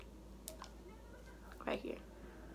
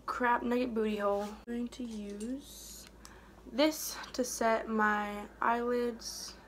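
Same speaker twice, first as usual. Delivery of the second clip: ordinary talk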